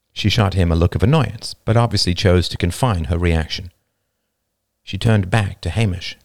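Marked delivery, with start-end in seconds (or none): none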